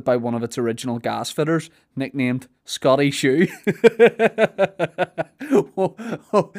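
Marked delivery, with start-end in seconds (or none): 3.7-6.5 high laughing "oh, oh". Real, not acted. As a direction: laugh through the words. Laughing at the silly name.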